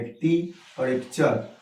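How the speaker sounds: slowly name टी and चर as separate syllables. Shown as a demonstration. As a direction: set टी apart from चर with a pause. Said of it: "दो syllable में बोलते हैं". (टी और चर अलग-अलग, धीरे)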